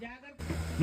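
breathless whispered caution; whispered warning. Acted. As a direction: hiss the warning under your breath. (గుసగుసగా హెచ్చరిక)

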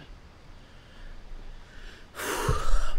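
long breath swelling into a loud exhale; heavy sigh. Real, not acted. Player recovering from a near slip, heavy sigh. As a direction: blow out the tension in a big sigh.

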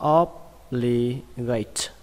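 obligate in three separated syllables; slow breakdown. Shown as a demonstration. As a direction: say obligate very slowly, pausing between syllables. Teacher breaks new word down. (đọc chậm obligate, tách từng âm tiết)

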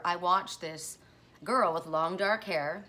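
normal talking voice

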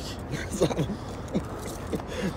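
soft breathless laugh rising up; stunned laugh. Real, not acted. Friend laughing softly, stunned by the gift. Handed the car key.

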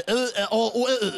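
mock Danish vowel babble. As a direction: spit out Danish vowels as nonsense.

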